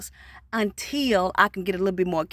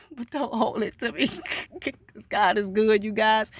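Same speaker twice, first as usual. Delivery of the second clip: laughing through the words; giggly, amused disclaimer. Real, not acted. Vlogger hedging her promise about a last video, laughing.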